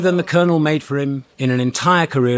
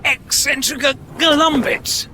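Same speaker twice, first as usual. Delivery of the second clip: high shouted retort; 12 semitones higher than usual. Acted. Calling out amid beast chase.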